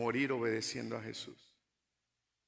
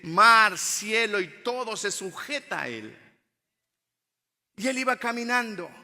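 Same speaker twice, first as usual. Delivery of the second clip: loud, higher-pitched sermon delivery; forceful preaching; 7 semitones higher, 13 dB louder than usual. Real, not acted. Preacher telling the church Jesus walks on the sea, forceful. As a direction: preach it out, hammering key words.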